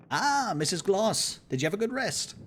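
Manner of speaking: a light officer's hello; friendly greeting. Acted. Voices Shaw greeting.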